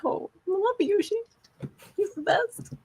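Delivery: very high, small gushing voice; adoring squeak. Real, not acted. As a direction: coo it tiny and high with affection. Gushing over a beloved character.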